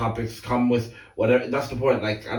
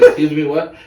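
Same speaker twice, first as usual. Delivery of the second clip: confused question; 4 semitones higher, 11 dB louder than usual. Retold or imitated. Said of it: "then he goes".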